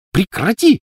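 put-on high, loud girlish squeal; shrieking. A narrator's reading. Petunia horrified by her sister's trick, shrieking. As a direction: squeal it as an order to stop. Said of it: "взвизгнула Петунья".

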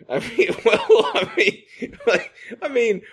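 words broken up by laughter; giggling while trying to explain; laughing. Real, not acted. Laughing while he explains to his friend.

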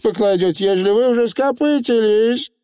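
high, cutting challenge; sharp witch question. A narrator's reading. Witch challenging the Feegle's logic, sharply. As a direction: pounce on the flaw in the argument. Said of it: "резко спросила ведьма".

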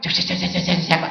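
imitating chatter; light mock chatter; chattering women. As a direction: mimic the ladies chattering. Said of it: "mấy bà cũng nói".

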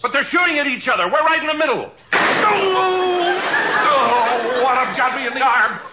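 panicked yelling. Acted. Sketch, caught in the crossfire.

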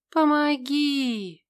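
slow, high, pained moaning cry; groaning for help, stretched out; стон. Acted. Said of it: "стонала я". (протяжный стон о помощи)

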